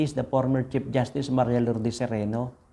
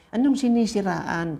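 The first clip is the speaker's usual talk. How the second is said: higher, scoffing "what smear?"; scoffing retort; 7 semitones higher than usual. Acted. Preacher scoffing back at critics who cry smear, higher mocking retort. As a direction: toss it back in disbelief.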